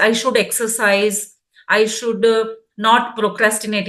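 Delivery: very slow, word by word; slow dictation. Shown as a demonstration. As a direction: dictate each resolution slowly. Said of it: "जैसे आप अपने गुण जब लिखेंगे". (धीरे-धीरे, एक-एक शब्द लिखवाते हुए)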